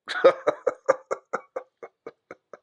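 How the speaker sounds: laugh. Real, not acted. Laughs at own joke.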